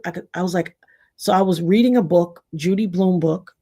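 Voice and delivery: ordinary delivery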